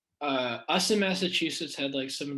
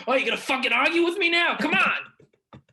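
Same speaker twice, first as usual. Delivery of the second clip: high, loud, fast mock rant; hammed-up shouting, much higher pitched; imitated angry yelling; 13 semitones higher, 9 dB louder than usual. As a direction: yell it like some guy arguing into his phone. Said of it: "fucking yelling at his phone".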